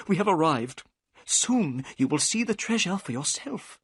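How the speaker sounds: breathless wonder at the treasure; excited. A narrator's reading. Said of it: "Thaddeus Sholto's voice became excited".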